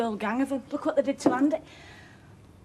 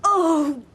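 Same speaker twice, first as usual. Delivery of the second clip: pained cry; 5 semitones higher, 10 dB louder than usual. Acted. Labour pain hits mother.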